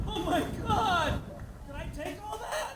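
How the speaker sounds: high, stunned question; mock shock. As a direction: gasp it, aghast. Waking up shocked.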